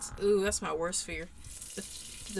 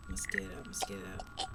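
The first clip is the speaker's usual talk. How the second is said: much lower, soft, otherworldly murmur; eerie inner voice; 13 semitones lower, 7 dB quieter than usual. Acted. A low creature voice inside the player's head. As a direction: let a deep alien voice drone from inside.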